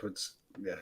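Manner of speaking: audio cutting out mid-word; line dropping out; bad connection. Real, not acted. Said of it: "So if I break up".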